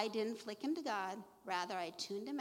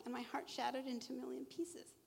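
usual speaking voice

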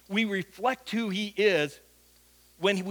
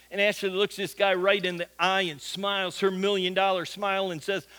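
ordinary talk

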